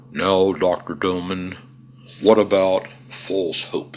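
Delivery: slow, lightly boomed Irish interviewer's question; a hint of booming Irish broadcaster, slow. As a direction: imitate a grand Irish radio host, drawn out. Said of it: "booming Irish voice".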